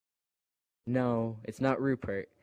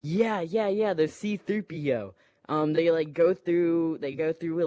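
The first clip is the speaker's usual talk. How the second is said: excited; 5 semitones higher, 5 dB louder than usual. Real, not acted. Excited reply to off-screen voice.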